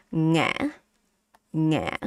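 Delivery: "nghe" with air caught in throat; heavy stopped tone. Shown as a demonstration. Teacher showing throat stop, heavy "nghe". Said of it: "stopping air more in your throat".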